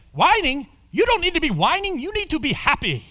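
put-on high, loud, quick Indian accent gently scolding; an Indian man cheerfully correcting the caller; broken English Indian accent. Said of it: "a little broken English himself".

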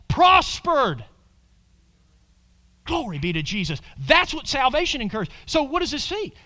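ordinary delivery

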